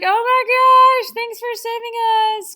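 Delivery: very high, loud, drawn-out squeal; mock gushing crowd. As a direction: squeal thanks like a hammed-up adoring crowd. Acting Austrians greeting Hitler.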